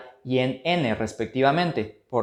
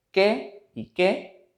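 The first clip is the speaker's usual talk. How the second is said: qué y qué said high and very slowly; slow raised qué contrast; 6 semitones higher, much slower than usual. Shown as a demonstration. Teacher demonstrating qué y qué high and slow. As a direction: lift and stretch each qué. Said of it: "para distinguir la función".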